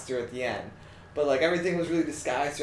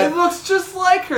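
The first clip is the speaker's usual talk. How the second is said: excited; 14 semitones higher, 6 dB louder, slower than usual. Real, not acted. Arguing about the actress.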